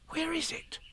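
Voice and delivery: soft, high whisper to himself. A narrator's reading. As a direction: breathe it out searching.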